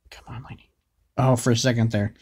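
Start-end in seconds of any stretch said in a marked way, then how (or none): 0.1-0.7 whisper. Real, not acted. Urging summon in hushed voice.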